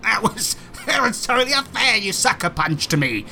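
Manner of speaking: high laughing whine of complaint; whiny spider. Acted. Wounded spider whining at the heroes about a sucker punch, high. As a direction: protest like a sore loser. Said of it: "he was like".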